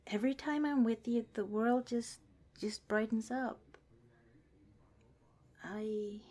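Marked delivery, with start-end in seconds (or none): none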